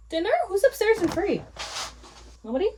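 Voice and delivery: high, loud call up the stairs. Real, not acted. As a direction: shout up to whoever is home. Calling through the house.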